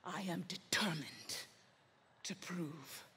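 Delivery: very low and soft, with long pauses; hushed villainous resolve. Acted. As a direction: sink almost to a whisper, confiding a dark plan. Villain confides his dark resolve.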